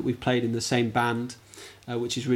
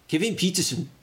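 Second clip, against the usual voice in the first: badly faked, higher-pitched South African accent; 6 semitones higher than usual. Shown as a demonstration. Quiz guest's shaky stab at a cricketer's South African accent. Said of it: "Kevin Peterson's from South Africa".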